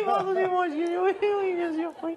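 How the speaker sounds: sudden high fluster. Acted. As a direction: jump way up, embarrassed. Flustered, caught on phone.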